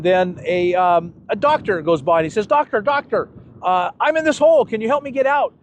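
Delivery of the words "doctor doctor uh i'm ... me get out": calling out. Retold or imitated. Man in hole calls doctor.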